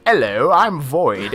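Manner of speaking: slow, deadpan villain voice; a lightly voiced bad cover of Void; mock botched Void. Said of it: "they'd make him like".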